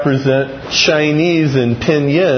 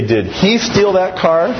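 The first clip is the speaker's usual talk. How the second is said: he stressed higher; 4 semitones higher than usual. Shown as a demonstration. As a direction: lean on he, as if someone else did it. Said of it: "five different things".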